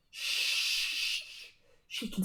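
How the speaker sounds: soft whispered shush; whispered shh to silence him; hushing whisper. Acted. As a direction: hiss a quick shush. Hushing past self.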